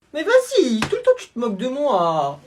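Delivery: a little brother crying out to tell on his sibling; whiny kid calling mom. Acted.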